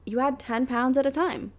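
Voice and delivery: ordinary talk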